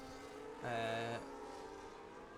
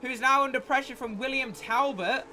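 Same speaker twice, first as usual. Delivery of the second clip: excited commentary; 11 semitones higher, 9 dB louder than usual. Real, not acted. Live race commentary call.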